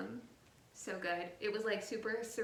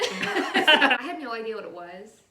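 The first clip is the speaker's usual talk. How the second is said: laughing; 2 semitones higher, 16 dB louder, slower than usual. Real, not acted. Laughing admission about the book.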